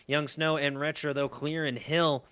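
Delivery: ordinary talk, nothing marked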